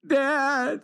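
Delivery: put-on high, drawn-out child's whine; whiny high call for a parent; little boy's cry voice. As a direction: imitate a tearful kid calling from upstairs. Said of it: "I hear the cry voice from upstairs".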